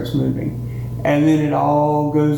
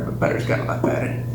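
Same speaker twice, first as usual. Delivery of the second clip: whispered aside; 3 dB quieter, much faster than usual. Acted. Aside about butter.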